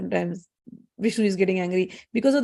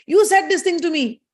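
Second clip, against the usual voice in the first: high, loud accusation; angry Saraswati; 8 semitones higher, 5 dB louder than usual. Retold or imitated. Saraswati accusing Brahma mid-fight, angry. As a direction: act out a wife accusing in a quarrel. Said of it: "So Saraswati is very angry".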